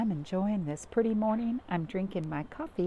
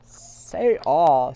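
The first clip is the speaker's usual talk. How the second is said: doctor; 4 semitones lower, 11 dB louder than usual. A narrator's reading. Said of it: "said the doctor".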